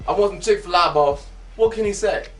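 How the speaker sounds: normal talking voice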